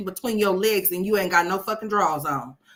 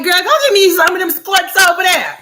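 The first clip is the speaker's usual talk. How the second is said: much higher and louder, hollering for the skirts; mock shout; 12 semitones higher, 11 dB louder than usual. Shopper yelling to the clerk for skirts, loud high mock shout. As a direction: holler it across the store. Customer calling for skirts in store.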